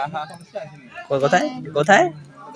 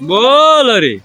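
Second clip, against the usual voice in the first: calling out; 10 semitones higher, 7 dB louder, much slower than usual. Real, not acted. Calling out to someone.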